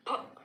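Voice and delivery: फ with closed lips and a burst of pressure; pressed P sound. Shown as a demonstration. Teacher shows students फ with lip pressure. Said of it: "lips को बंद करके और pressure के साथ".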